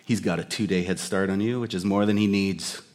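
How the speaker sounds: lightly voiced Indiana Jones. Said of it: "But Indy's reply".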